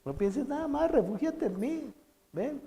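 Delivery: high, soft and tender, drawing them in; tender invitation. Acted. Preacher voicing the Lord inviting the frightened listener to shelter, high and soft. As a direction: coax gently like a loving father calling. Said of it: "no, te dice".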